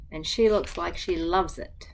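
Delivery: usual speaking voice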